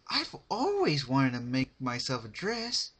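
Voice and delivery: high, bubbly, sing-song; cheery Pinkie voice. A narrator's reading. Pinkie asking her captive for advice, high and bubbly. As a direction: chirp it like a sweet party pony.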